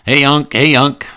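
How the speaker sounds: put-on high, loud boyish call; kid calling out. A nephew calling his uncle, high and eager.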